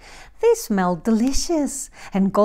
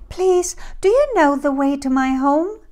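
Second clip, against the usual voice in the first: put-on higher, louder little girl plea; pleading Goldilocks; 5 semitones higher, 5 dB louder than usual. A narrator's reading. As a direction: ask for help as a lost girl. Goldilocks asking the bears for help.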